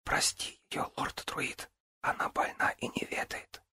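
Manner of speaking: hushed whisper. Acted. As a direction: whisper it gently. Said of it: "тихонько прошептала".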